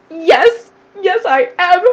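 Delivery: high, loud, elated retold yes; happy. Mother answering stepdaughter who noticed she's happy, elated. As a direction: burst out with emphatic, high-pitched agreement. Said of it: "You're, you're happy".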